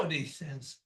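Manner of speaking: usual speaking voice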